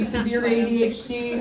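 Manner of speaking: usual speaking voice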